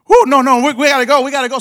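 high, loud panicked escape; hammed-up fright, bolting; mock panic. Pastor acting the Jehovah's Witness running off, in loud mock panic. Visitors fleeing from prayer offer.

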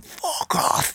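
high-pitched mock yell, held back in volume; retold shout. Aunt yelling as he wins his fight, high mock shout. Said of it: "me auntie's face shouting".

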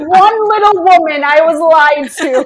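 loud, high cry of betrayal; loudly aggrieved, half joking; mock outrage. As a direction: wail it as a cheated customer, drawing out the end. Mock outrage over a cover.

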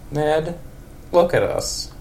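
put-on high wavering old lady voice. Old lady Marge answers Ned after their night. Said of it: "old lady for Marge".

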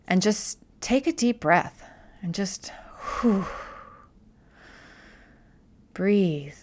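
soft guided breath, nearly voiceless; breathy calming whisper. Shown as a demonstration. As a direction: whisper it out on a long exhale.

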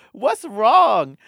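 loud, high mock mom call; concerned mom. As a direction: imitate a mother calling out in alarm. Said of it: "you hear his mom go".

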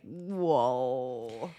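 low, drawn-out whoa; a low, wary whoa; a low, unimpressed whoa; deep mock whoa. Real, not acted. Low far reaction.

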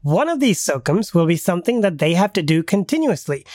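ordinary delivery

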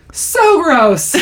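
high, hammed-up exclamation; excited disgust. Brother reporting on the newborn, excited. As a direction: blurt it with gleeful revulsion. Acting Frank's excited answer.

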